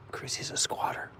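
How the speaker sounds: unvoiced whisper aside. Real, not acted. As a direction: whisper it like a secret. Whispered joke about dog.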